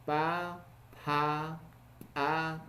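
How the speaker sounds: three drawn-out bilabial PA syllables, separately; consonant pronunciation drill. Shown as a demonstration. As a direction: sound out each PA for learners. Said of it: "pronunciando estos consonantes".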